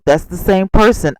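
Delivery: ordinary talk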